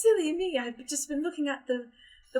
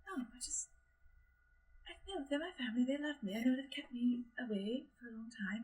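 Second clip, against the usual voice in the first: soft, much quieter, half-whispered reassurance; hushed; 8 dB quieter than usual. Acted. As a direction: hush it as if nobody may hear. Josephine's soft, hushed confession.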